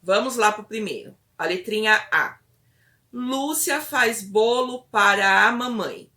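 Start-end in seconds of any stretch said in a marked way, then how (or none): 3.1-6.1 frase ditada devagar, bem articulada; dicção clara. Shown as a demonstration. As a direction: dite devagar para a criança repetir. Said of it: "Fale de uma maneira clara". (fala pausada, com boa pronúncia)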